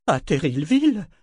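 high, incredulous retort. A narrator's reading. Ronica answers the cry of bandits, retorting in disbelief. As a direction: throw it back as a disbelieving question. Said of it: "rétorqua Ronica".

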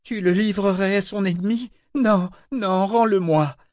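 high, distressed disbelief; wailing, uncomprehending plea; moaning protest. A narrator's reading. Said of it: "gémit Eta sans comprendre".

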